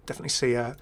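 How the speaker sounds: usual speaking voice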